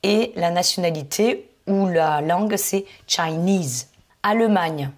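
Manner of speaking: ordinary talk, nothing marked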